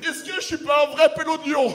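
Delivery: loud high boast; hammed-up Lyon pride yell; proud shout. Bragging he is real Lyon.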